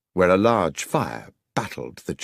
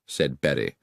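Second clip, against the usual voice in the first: plain reading voice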